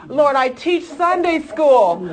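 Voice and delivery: a Pharisee boasting to God so that everyone hears; smug Pharisee prayer. Retold or imitated. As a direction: ham up a self-righteous prayer said for show. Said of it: "don't be like the Pharisees that pray in the open and says".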